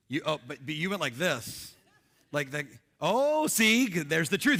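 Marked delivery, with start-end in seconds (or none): 3.0-4.6 slow, louder teasing call-out; slow mocking gotcha. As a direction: catch him out, drawn out and loud.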